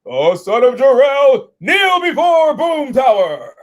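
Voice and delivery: high, loud, drawn-out mock proclamation; over-the-top tyrant's command; hammed-up villain. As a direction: bellow it slowly like a pompous conqueror.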